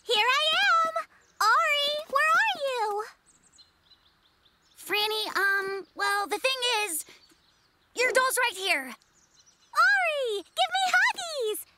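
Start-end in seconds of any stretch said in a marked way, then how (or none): 0.0-3.0 calling out. Acted. Calling out for doll. 8.9-11.7 high, eager squeal; gush, pitched way up; excited. Acted. Child greeting doll.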